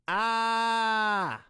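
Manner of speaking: high open "ah" pushed from the belly; diaphragm push "ah". Shown as a demonstration. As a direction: shout the "ah" from the diaphragm. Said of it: "When I am making that noise".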